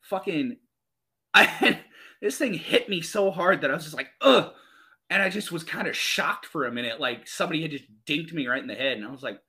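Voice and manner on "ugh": high, loud "ugh"; pained grunt. Retold or imitated. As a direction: imitate the grunt from a hard blow. Retelling being hit hard.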